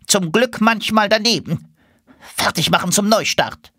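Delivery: high-pitched commanding duck; rally the flock in a high voice; duck leader. Acted. Duck leader orders restart.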